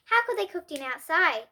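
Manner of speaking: ordinary delivery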